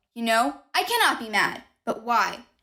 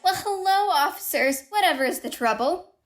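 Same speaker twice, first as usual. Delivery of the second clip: all politeness; 6 semitones higher than usual. Acted. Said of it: "I answered, all politeness".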